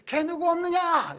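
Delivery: calling out high, loud and slow; mock shout for help. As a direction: yell for the servants across the threshing floor. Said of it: "이렇게 소리를 질러가지고요".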